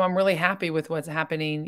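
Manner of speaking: ordinary talk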